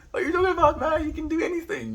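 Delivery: very high-pitched mock disbelief; incredulous pep talk. Retold pep talk to self.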